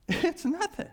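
high exasperated squeak, easing off in volume; high-pitched repeat. Acted. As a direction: keep it high but let the force drop. Repeats the outburst, less loud.

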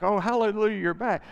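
loud mock "hallelujah"; resentful member greets returning prodigal with loud sarcasm; sarcastic welcome. Hammed-up sarcastic cheer.

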